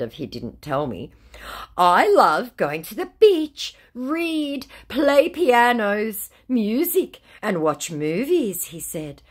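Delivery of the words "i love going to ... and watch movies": higher, louder, slow sing-song list; mock dreamy scammer. Scammer listing what he loves, slow mock dreamy. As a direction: savour each hobby like a fake romantic.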